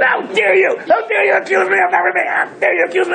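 very high, imitated outraged shout; hammed-up explosion of indignation; blowing up. Man refused an approval blows up at the accusation, mock shouting. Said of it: "He blew up".

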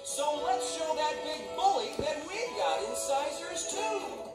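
high-pitched plucky beaver speech; storybook pep talk from a little beaver; small beaver rallying. A narrator's reading.

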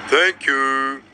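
put-on character voice, drawn-out thanks; steady, level-toned storybook musician; tuba player voice. A narrator's reading. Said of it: "said the tuba player".

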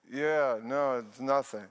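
slow, drowsy brush-off; mock sleepy dismissal. Tired husband to wife in bed, drowsy and dismissive. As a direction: play too tired to care. Acting himself too tired to care.

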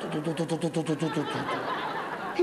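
low rhythmic to-to-to, fading away; helicopter chop. Acted. Comedienne imitates the helicopter leaving, low fading chop. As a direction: let the rotor chop recede.

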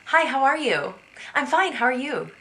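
cheery shop greeting. Retold or imitated. A shop clerk greeting a customer, high and cheery. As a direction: greet a stranger the way a cashier would.

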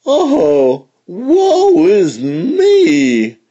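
very slow, loud, high wail; hammed-up wail of despair; hollering lament. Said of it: "Fox hollered".

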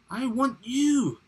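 high, loud, drawn-out crocodile voice; theatrical high-pitched demand; declaring crocodile. A narrator's reading. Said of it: "Crocodile declared".

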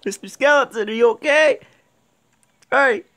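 high, playful fake worry; mock concern. Gamer checking on a skeleton, mock concern. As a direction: coo at him in jest.

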